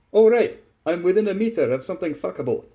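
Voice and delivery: loud, high sudden enthusiasm; eager. A narrator's reading. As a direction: jump at the chance. Tachanka voiced in story.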